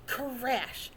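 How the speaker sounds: plain reading voice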